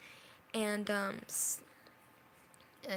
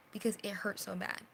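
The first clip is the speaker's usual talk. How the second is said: usual speaking voice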